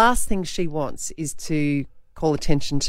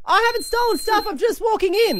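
high, sing-song I'm-innocent voice; hammed-up high pleading; mock innocent protest. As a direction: play the nervous shopper pleading innocence. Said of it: "like this".

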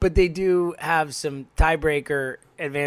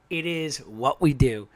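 normal talking voice

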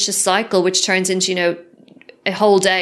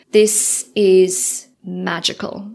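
awed slow; much slower than usual. Retold or imitated. Telling the facilitator the week felt magical.

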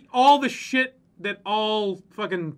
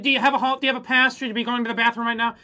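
high, loud, prissy nagging; nagging hall monitor; 4 semitones higher, 5 dB louder, faster than usual. Pretend. Mocking a school hall monitor, nagging and prissy. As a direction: whine like a petty hall monitor.

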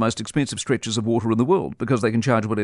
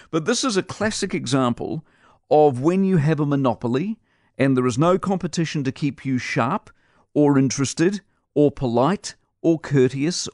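ordinary talk, nothing marked

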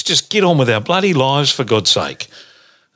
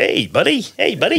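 higher-pitched American "hey, buddy"; mock American accent; 6 semitones higher than usual. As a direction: chirp an American greeting in parody. Joining in mocking American greetings.

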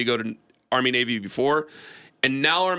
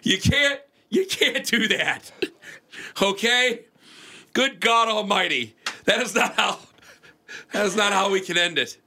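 high voice fighting back laughter; stifled giggles; 9 semitones higher than usual. Real, not acted. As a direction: try not to crack up. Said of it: "I'm trying not to laugh".

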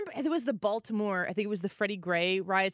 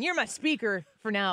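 ordinary delivery

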